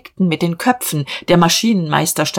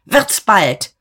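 raised, higher-pitched command; impatient shout; 4 semitones higher, 3 dB louder than usual. A narrator's reading. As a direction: bark it to hurry them up. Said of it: "schrie Diederich".

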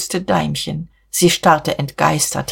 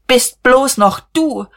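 higher-pitched heated comeback; indignant acted snap; quarrelsome retort; 7 semitones higher, 4 dB louder than usual. A narrator's reading. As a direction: throw the insult straight back. Acted quarrel, insult thrown back.

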